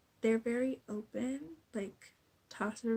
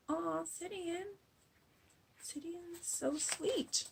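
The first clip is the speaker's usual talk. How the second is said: higher and slow, doting coo; drawn-out sigh of adoration; cooing aww; 8 semitones higher, slower than usual. Retold or imitated. Vlogger cooing over Sidian's sweetness, doting.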